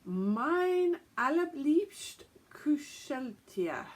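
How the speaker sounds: high, very slow German reading; attempted German pronunciation. Shown as a demonstration. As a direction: sound out the German word by word, slowly. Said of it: "my German pronunciation might be better".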